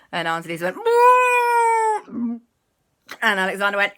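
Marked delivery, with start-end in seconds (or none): 0.8-2.4 high, loud, long whoop; acted thrill whoop. Aunt on the loop-de-loop, high wailing whoop.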